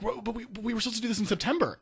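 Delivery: fast, high confused objection; the world caught off guard; mock protest. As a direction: blurt a flustered complaint, quickly. World protests in acted quote.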